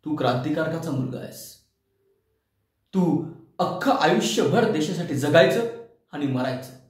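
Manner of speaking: low, lightly voiced public expectation; people's admonition. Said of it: "अशी जशी लोकांची कल्पना असते". (लोकांची खालच्या आवाजातली समज)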